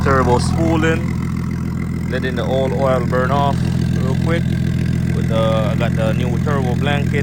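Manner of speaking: talking loudly over a revving engine. Real, not acted. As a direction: raise your voice over engine noise.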